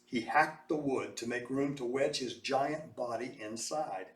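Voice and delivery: ordinary delivery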